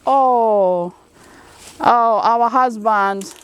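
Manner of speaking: long, drawn-out lament; mock exasperation. Woman on the phone complaining about husbands, mildly exasperated. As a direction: imitate a mildly exasperated wife, stretching the words slowly.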